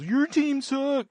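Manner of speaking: high, slow sneering sports fan taunt; mock trash talker. Mocking the fan who trash-talks, high and slow. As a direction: drag it out like a jeering rival fan. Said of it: "I've never been one of those".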